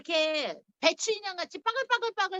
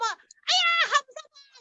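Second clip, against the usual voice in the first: high-pitched mock outcry; mock scream; 11 semitones higher, 4 dB louder, slower than usual. Acting out someone groped in a market, mock scream. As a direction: yelp it high, as if indignant. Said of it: "이렇게 소리를 지르죠".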